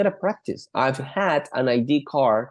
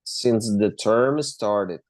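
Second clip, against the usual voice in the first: slow, careful teacher model; slow model sentence; 3 semitones lower, much slower than usual. Shown as a demonstration. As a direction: spell it out slowly for the student. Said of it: "que eso es como una expresión".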